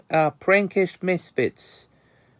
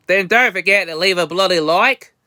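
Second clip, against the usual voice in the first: fake Aussie accent; 4 semitones higher than usual. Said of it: "worst fake Aussie accent".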